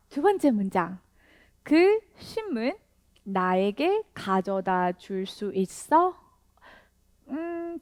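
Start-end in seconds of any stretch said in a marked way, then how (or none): none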